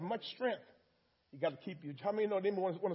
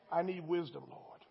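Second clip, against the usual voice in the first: ordinary talk, nothing marked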